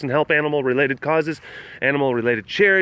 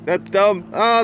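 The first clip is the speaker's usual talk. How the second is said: ordinary delivery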